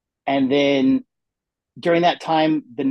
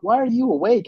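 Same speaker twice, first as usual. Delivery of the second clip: surprised doctor voice; 8 semitones higher than usual. Retold or imitated.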